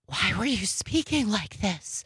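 breathy, hushed imitation; mock her breathy delivery; breathy whisper. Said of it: "Why are we whispering?".

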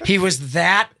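ordinary talk, nothing marked

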